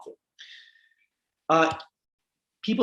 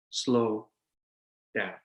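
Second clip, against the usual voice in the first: slow down with a long pause between the two words; slowed demo; 4 semitones lower, 4 dB quieter than usual. Shown as a demonstration. Coaching young lawyers to slow down, drawn-out and paused. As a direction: stretch "Slow" out, then a long gap before "down.".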